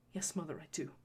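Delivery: plain reading voice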